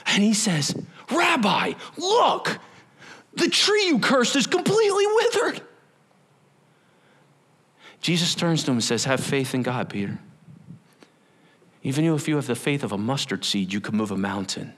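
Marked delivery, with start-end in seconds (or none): 1.1-5.6 much higher, astonished; amazed Peter. Retold or imitated. Peter amazed at withered tree. 9.0-14.7 lower, softer and steady; teacher calmly answering his disciple; calm Jesus. Retold or imitated.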